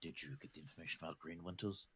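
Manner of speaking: low, hushed gruff boss; gruff NPC voice. Acted. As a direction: ask it like a terse fixer. Playing NPC Yurg.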